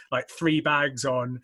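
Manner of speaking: ordinary talk, nothing marked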